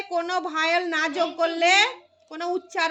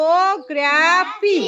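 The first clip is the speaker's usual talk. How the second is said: Orthography louder and slow, syllable by syllable; broken into syllables; 5 dB louder, slower than usual. Shown as a demonstration. Teacher showing where hyphens go, orthography in loud, slow syllables. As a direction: punch out each syllable of orthography with gaps between. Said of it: "শব্দের মধ্যে মধ্যে একটু করে hyphen দিলাম".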